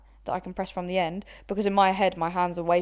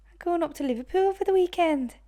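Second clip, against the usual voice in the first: high, sing-song Scouse impression; Scouse accent; 10 semitones higher than usual. Friend tries a Liverpool accent for the challenge. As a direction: ham up a Liverpool lilt. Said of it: "do a Liverpool accent".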